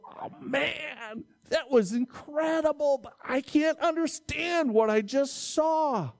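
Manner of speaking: amazed disbelief. Retold or imitated. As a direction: play someone who can't believe what they saw. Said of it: "you go".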